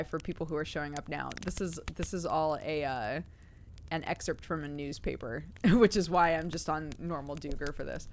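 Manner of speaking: ordinary delivery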